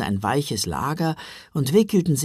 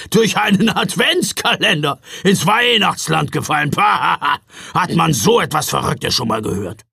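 louder, higher blustering scoff; 4 semitones higher, 6 dB louder than usual. A narrator's reading. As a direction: scoff loudly at the nonsense. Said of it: "polterte Knecht Ruprecht". (Ruprecht poltert spöttisch und laut)

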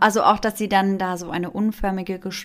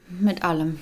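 usual speaking voice

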